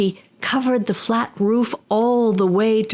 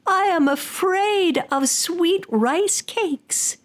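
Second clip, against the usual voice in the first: high, slow mock-frightened old woman; mock fear; 9 semitones higher, slower than usual. Old woman pretending fear to trick the Tengu, high and slow. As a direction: fake terror to trick the demon. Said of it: "And she said".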